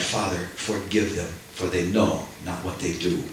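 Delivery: soft, hushed, a quiet dying plea. Retold or imitated. Preacher voicing Jesus on the cross, hushed and soft. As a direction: breathe it out gently as a prayer.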